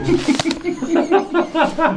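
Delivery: high laughter; real laughter. Real, not acted. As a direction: crack up. Laughs at own joke.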